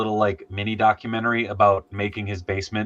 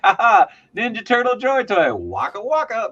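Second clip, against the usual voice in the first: goofy high voice; 12 semitones higher, 4 dB louder than usual. Retold or imitated. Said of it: "and I was like".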